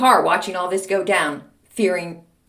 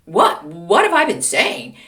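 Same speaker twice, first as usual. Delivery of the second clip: louder, higher mock-innocent protest; feigned ignorance; 4 semitones higher, 7 dB louder, slower than usual. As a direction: imitate a teacher playing dumb, stretched out. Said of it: "he's pretending like he doesn't remember".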